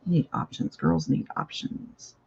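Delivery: breathy half-whispered joke to herself; whispered aside. Real, not acted. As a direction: slip in a sly whispered aside. Playful aside after options.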